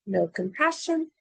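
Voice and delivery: plain reading voice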